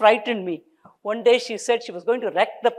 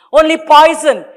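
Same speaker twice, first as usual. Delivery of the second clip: loud furious cursing; wife cursing husband in rage; 8 semitones higher, 12 dB louder than usual. A narrator's reading. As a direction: spit the curse at him. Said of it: "started cursing him".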